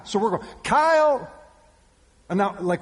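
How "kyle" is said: put-on loud, high-pitched call for a child; yelling. Father calling his lost toddler across a store, yelling. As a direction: yell out for your missing kid. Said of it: "we're, we're yelling".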